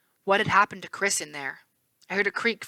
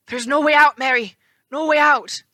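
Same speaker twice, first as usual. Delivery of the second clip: higher, louder desperate cry; a cornered rat, wild and desperate; 8 semitones higher, 7 dB louder than usual. A narrator's reading. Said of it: "wild and desperate".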